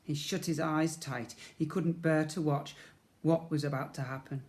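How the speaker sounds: ordinary delivery, nothing marked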